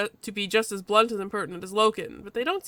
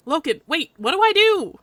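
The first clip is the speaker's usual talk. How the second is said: higher, louder plea rising on the question; anxious protagonist; 7 semitones higher, 9 dB louder than usual. Acted. Player asks [name] what to do, anxious. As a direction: urgently plead for direction.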